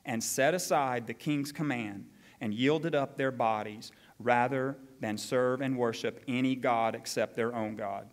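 measured, formal Bible reading; scripture reading. Acted. Pastor reading the decree to the congregation, formal and measured. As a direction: read the king's decree with weight.

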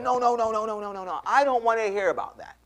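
high-pitched, rapid string of retold no's; emphatic refusal. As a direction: cut the pitch off as the impatient woman. Woman cutting off his sales pitch.

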